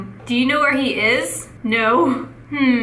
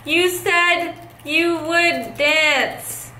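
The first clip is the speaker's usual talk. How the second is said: high, drawn-out fake-cry whine; hammed-up heartbroken plea, dragged out slowly; mock wailing protest; 4 semitones higher, much slower than usual. Pleading with a player who promised to dance, mock wailing.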